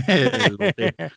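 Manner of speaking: laughing. Acted. Joking mishearing, laughing.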